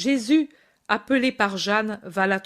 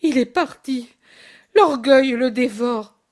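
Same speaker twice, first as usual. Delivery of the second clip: moaning; 3 semitones higher, 5 dB louder than usual. Acted. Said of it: "gémit sa mère".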